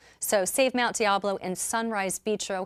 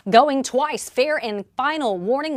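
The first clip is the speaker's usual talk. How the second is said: loud high auctioneer call; fish market auctioneer; 5 semitones higher, 6 dB louder than usual. Real, not acted. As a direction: hammer it out, going twice. Auction countdown to close bidding.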